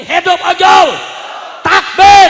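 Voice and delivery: high, full-force crowd call; loud cries demanding a response; rallying shout. Real, not acted. Rallying other side of crowd.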